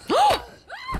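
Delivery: sharp, high gasp; shocked gasp. Real, not acted. Viewer watching a violent film scene, shocked gasp.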